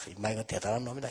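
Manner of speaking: fast, soft admiring aside; muttered quick admiration; quick inner thought. Retold or imitated. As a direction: think it to yourself in a rush.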